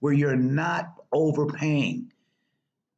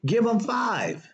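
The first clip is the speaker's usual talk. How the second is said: higher, slow and shouted; emphatic shout; 7 semitones higher, slower than usual. Real, not acted. Urging viewers to withhold less, slow high shout. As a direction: drive home the point, slowly and high.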